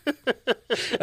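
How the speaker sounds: barely holding it together, cracking up; giggling fit; laughing while talking. Real, not acted. Laughing at Gandalf image.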